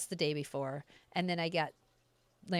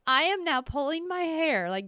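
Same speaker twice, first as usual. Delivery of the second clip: usual speaking voice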